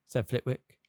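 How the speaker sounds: plain reading voice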